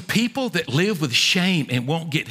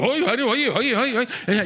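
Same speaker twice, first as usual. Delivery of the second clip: mock neighbor reply; 7 semitones higher than usual. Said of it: "Joe's going".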